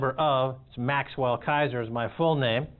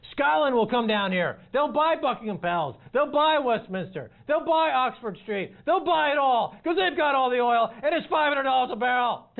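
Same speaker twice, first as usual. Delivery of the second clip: excited; 8 semitones higher than usual. Real, not acted. Building comic rant about buying London.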